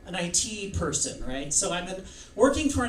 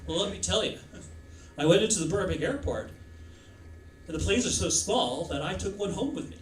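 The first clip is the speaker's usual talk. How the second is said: Rodney Dangerfield; faster than usual. Acted. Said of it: "Rodney Dangerfield".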